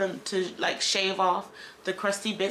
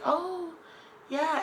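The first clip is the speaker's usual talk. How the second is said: surprised oh yeah; 10 semitones higher, 3 dB quieter than usual. Retold or imitated. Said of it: "And I was like".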